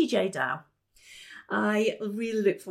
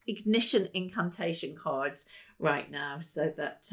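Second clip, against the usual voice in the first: ordinary talk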